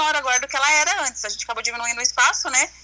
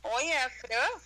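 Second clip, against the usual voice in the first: over the phone; 7 dB quieter, slower than usual. Real, not acted. Estão ouvindo?.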